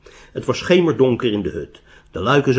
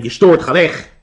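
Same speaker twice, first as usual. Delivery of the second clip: loud, raised rebuff from inside; heavy voice; 6 semitones higher, 7 dB louder than usual. A narrator's reading. Hermit to a stranger knocking at his door, heavy-voiced and loud. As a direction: send the intruder off forcefully in a heavy voice. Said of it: "klonk er een zware stem".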